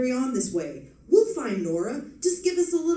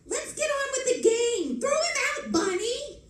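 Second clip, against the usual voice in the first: high, annoyed yell; angry yell. Acted. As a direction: yell at the host to throw him out. Gambler yells at intruder.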